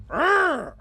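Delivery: high, short disgusted urgh; frustrated groan. Real, not acted. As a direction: let out a fed-up growl. Fed-up groan.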